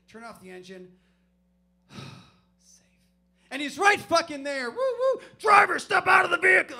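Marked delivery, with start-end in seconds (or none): 3.5-4.7 shouted punchline; loud exclamation. Acted. Punchline, cop right behind. 4.7-6.8 high, loud siren and cop bark; cop megaphone shout. Retold or imitated. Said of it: "I didn't say that".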